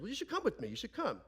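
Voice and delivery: lightly mimicked uncle urging him along; coaxing uncle. Uncle urging the father to come along, coaxing. Uncle voiced in retold phone call.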